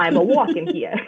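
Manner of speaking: hammed-up phony New York accent. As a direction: do a bad actor's New Yorker. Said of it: "I'm from New York".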